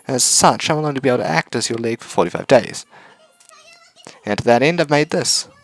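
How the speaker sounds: put-on high, bright girlish voice; cheerful robot girl voice. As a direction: chirp it like a cute robot. Robot speaks.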